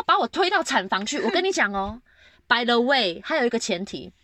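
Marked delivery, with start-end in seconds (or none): none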